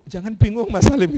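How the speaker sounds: loud retold brush-off of worry; emphatic mock insistence from the owner; insistent reassurance. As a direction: cut in forcefully to settle his doubts. Owner cuts off his hesitation.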